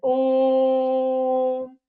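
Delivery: ओम sustained on one level pitch; triple-length vowel. Shown as a demonstration. Teacher showing how the vowel stretches, hugely prolonged. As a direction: draw ओम out far beyond a normal vowel. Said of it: "खिंचता है".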